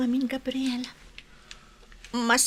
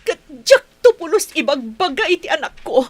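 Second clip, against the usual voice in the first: angry shout; 4 semitones higher, 10 dB louder than usual. Acted. Joy storms out, leaving him.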